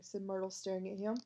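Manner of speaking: ordinary delivery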